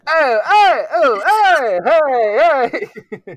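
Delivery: loud, high-pitched Italian American hollering; hammed-up Italian-American street protest; Italian American shouting. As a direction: yell it like a cartoon Italian American. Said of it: "Italian American".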